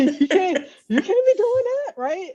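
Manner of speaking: high, laughing mock scold from family. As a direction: play the shocked relative, half laughing. Imitating family's disapproving reaction.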